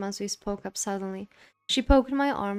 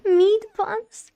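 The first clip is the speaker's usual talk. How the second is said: excited shout; 12 semitones higher, 11 dB louder than usual. Acted. Girl shouts at the food.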